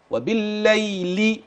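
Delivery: وبالليل drawn out, doubled lam held thin; slow demo of the thin lam. Shown as a demonstration. Teacher showing the doubled lam, slow recitation. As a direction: stretch وبالليل slowly, keep the lam light. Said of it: "يعني اللام مشددة في الأول".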